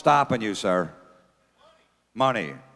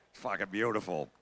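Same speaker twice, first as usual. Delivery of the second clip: ordinary talk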